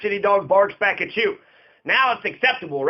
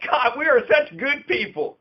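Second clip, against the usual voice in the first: higher-pitched, mocking dog-owner voice; mock doting dog owners; 5 semitones higher than usual. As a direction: gush proudly in mockery, pitched up. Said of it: "maybe they just thought everybody loved it".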